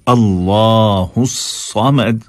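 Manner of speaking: "समद" slow, with heavy Arabic saad; drawn-out tajweed "समद"; emphatic saad. Shown as a demonstration. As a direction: recite "अल्लाहुस समद" with thick s and full laa. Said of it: "साद".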